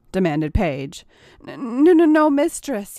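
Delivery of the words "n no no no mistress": higher and louder, stumbling panicked no; hurried stammer. Acted. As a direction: rush the denials, tripping over the first one. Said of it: "he hurriedly said".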